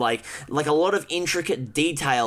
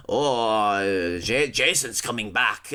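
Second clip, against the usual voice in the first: mock doomsaying sailor; 7 semitones lower, slower than usual. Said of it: "this random sailor dude that's just like".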